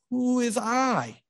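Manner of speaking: high, slow, wary voice of a sick woman; frightened woman. A narrator's reading. Sick widow questioning the stranger, wary. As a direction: ask nervously through the door.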